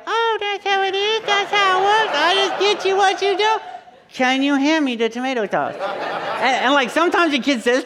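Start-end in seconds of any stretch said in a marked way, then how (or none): 0.0-5.7 much higher, hammed-up sarcastic dad voice; a child's high parody of his father; son mocking dad. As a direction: mimic how the kid would mock your sarcasm. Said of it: "you were gonna be all like".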